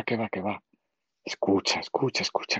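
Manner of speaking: soft, breathy escucha, escucha; whispered aside. Real, not acted. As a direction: lean in and whisper it close. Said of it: "te lo cuento a ti al oído".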